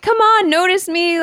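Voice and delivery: high-pitched playful begging; mock pleading. Swimmer begging to be noticed, mock pleading. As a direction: plead for attention, lightly hammed up.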